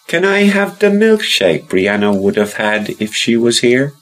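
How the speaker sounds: put-on high little boy voice; child voice. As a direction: voice young Rory, high and eager. Rory asks again.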